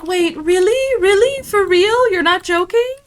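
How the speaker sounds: high, loud, incredulous grandma; imitating grandma's disbelief. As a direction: squeal it like a stunned, thrilled grandma. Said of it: "And she was like".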